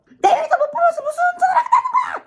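very high, frantic mock screaming; retold dad's shouting. Dad berates daughter over navigation, mock scream. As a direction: imitate a father losing his temper, shrieking. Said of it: "또 이렇게 소리를 지르는 거죠".

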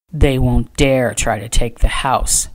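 low, growled dismissal. A narrator's reading. Trader dismissing the threat to the house, growled. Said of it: "Trader growled".

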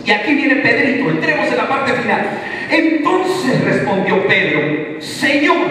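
high, loud fervent preaching; full-force preaching. Acted. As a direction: proclaim it to the whole church.

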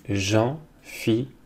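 Jean-Philippe broken into Jean, Phi, lippe with gaps; slow, separated syllables in Jean-Philippe. Shown as a demonstration. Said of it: "ça fait trois syllabes".

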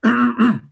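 loud, forced throat clearing; throat-clearing demo. Shown as a demonstration. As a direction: clear your throat to show the tic. (carraspeo forzado de demostración)

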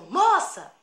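loud, high call; sudden shout. Retold or imitated. Robber calling after fleeing girl, sudden shout. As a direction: yell it out sharply after hushed talk. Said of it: "Aí ele gritou".

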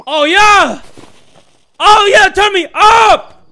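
loud, high victory yell; over-excited shout. Real, not acted. Gamer whooping over a lucky unlock. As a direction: belt it out like you just won. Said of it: "I'm getting a little too excited here".

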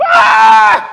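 loud high astonished scream. Retold or imitated. Boys seeing Jesus, loud astonished scream. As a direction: shriek in amazement.

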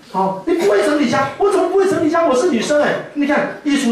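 high, hammed-up indignant retort; mock indignant wife, pitched high. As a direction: protest like an offended wife. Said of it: "wife retorts to husband".

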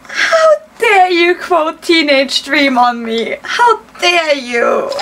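slow, high, tearful mock outrage; mock indignation. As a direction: draw it out as if wounded by the compliment. Mock outrage at quoted song.

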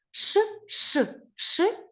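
high, long shushing wind sound; wind hushing. Shown as a demonstration. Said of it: "Виразно прочитай".

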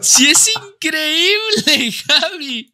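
slow, high squeal of delight; celebrate, stretching words high with a laugh; high, laughing jubilation; elated. Real, not acted. Celebrates own drawing guessed.

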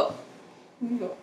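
slow whisper to herself. Real, not acted. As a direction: breathe it out, barely voiced.

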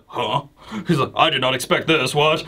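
a dazed elder caught off guard; a touch of a baffled villain. Acted.

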